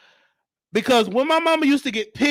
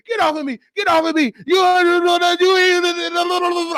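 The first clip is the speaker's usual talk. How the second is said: mock angry shout, a bit higher; mama yelling; 7 semitones higher, faster than usual. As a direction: play mama yelling on the porch. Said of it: "I can just remember my mama voice".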